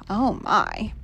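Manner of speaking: usual speaking voice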